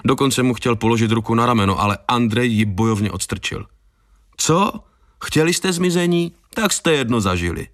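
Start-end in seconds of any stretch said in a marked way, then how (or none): 4.4-7.7 defiant retort. A narrator's reading. Prankster snaps back at the group.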